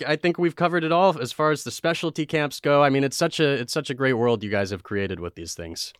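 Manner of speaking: usual speaking voice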